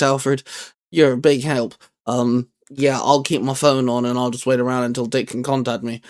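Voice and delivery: ordinary delivery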